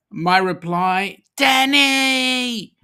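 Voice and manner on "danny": high, loud drawn-out name call; mock calling out. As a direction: holler a playful reply, stretching the name. His shouted reply to Danny.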